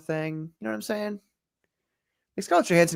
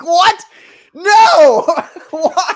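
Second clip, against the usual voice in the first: high, loud laughing outcry; comically aghast, much higher and louder; mock disbelief; 12 dB louder, much slower than usual. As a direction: wail it incredulously through laughter. Mock outrage at a casting choice.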